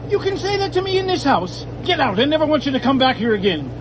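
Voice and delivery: high, loud furious dad; angry father. Acted. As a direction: throw her out, high and loud. Father throws daughter out.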